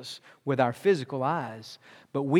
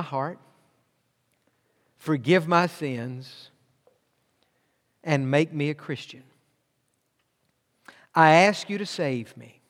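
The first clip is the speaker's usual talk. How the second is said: guided prayer. Acted. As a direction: lead them gently through the prayer. Leads a sinner's prayer.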